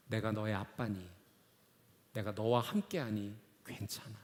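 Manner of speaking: low, soft, unhurried reassurance; comforting father's voice. Retold or imitated. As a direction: hush it like a father soothing his child. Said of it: "했던 그 음성 그대로".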